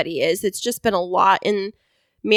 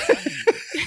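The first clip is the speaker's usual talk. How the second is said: short high real laugh; burst of laughter; 10 semitones higher, 4 dB quieter than usual. Real, not acted. Host breaking up while complaining, short high laugh. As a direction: laugh despite yourself.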